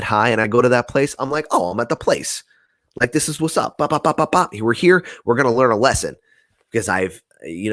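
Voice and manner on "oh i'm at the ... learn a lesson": bubbling over with glee; excited arrival. Retold or imitated. Stoned guy arriving at the familiar place, excited.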